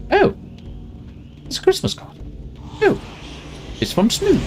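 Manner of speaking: squeaky high delighted gasp of surprise; mock surprise. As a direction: act out opening the card, thrilled. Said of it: "I was like".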